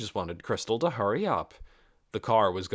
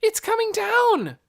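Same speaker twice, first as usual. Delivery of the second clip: high, loud warning; girl warning about the bus; alarmed cry; 8 dB louder than usual. Acted. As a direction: yell in alarm. Nikki alarmed.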